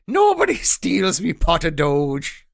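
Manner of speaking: slow, loud Irish leprechaun growl; mock Irish leprechaun. Cohost doing a crypto leprechaun, hammed Irish accent. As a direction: ham up an Irish leprechaun threat. Joke leprechaun NFT line.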